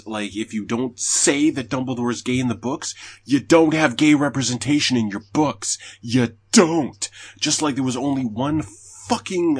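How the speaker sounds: loud, high, getting worked up; building frustrated outburst; heated rant. Real, not acted. Heated rant about representation.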